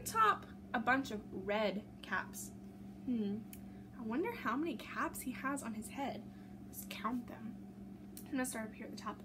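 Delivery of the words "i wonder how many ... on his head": ordinary delivery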